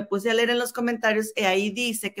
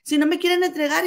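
mock angry lady; 7 semitones higher, 4 dB louder than usual. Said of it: "La señora bien enojada".

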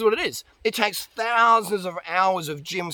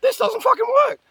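much higher, louder whiny complaint; whiny dieter; 5 dB louder, faster than usual. Retold or imitated. Client mocked for no weight loss, high and whiny. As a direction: squeal it like a disappointed dieter. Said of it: "you go".

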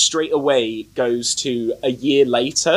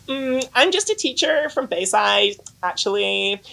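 mock teacher; 6 semitones higher than usual. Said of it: "'Cause she's like".

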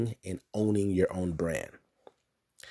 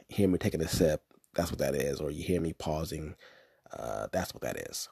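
ordinary delivery

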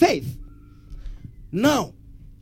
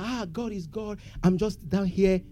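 mock resigned believer; 10 dB quieter than usual. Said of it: "If you have the mindset that".

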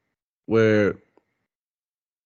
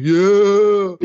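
hyped bro yeah; 8 semitones higher, 6 dB louder than usual. Retold or imitated. Bro answer acted again.

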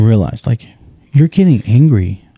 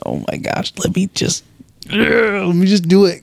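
higher, strained, fed-up sigh of impatience; itching to grab the task back from her; exasperated inner voice; 9 semitones higher, 4 dB quieter than usual. Retold or imitated. Dad watching his daughter struggle, exasperated and impatient.

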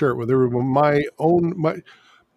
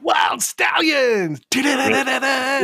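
high, slow rock-star yell; rock shout; 11 semitones higher, slower than usual. Retold or imitated. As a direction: scream it like a rocker.

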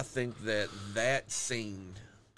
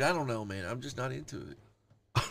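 ordinary talk

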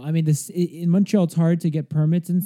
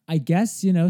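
ordinary delivery

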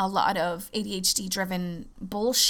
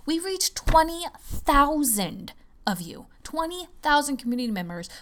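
thrilled, higher and louder; excited announcement; 7 semitones higher, 5 dB louder than usual. Real, not acted. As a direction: burst out with the big news. Announcing big milestone.

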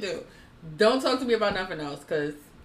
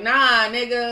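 usual speaking voice

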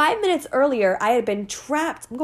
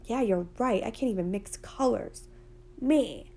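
much softer, deflated giving in; quiet, beaten-down self-talk; defeated agreement; 9 dB quieter than usual. Pretend. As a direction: mumble it like you've given up. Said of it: "And you're like".

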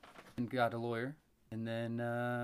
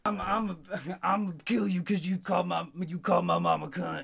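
louder, higher mock yell; retelling the son's threat at a louder yell; retold yell; 5 semitones higher, 6 dB louder than usual. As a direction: yell the threat like the angry son. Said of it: "he fucking screams out his window".

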